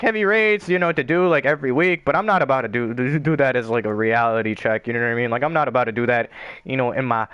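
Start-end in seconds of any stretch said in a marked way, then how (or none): none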